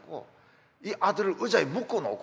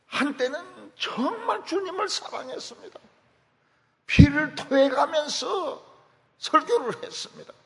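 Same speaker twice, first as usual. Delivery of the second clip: much higher, loud preaching cry; impassioned preaching; 10 semitones higher, 5 dB louder than usual. Acted. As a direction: climb to a fervent shout, slowing on 피를 토해가면서. Preacher rising to impassioned climax.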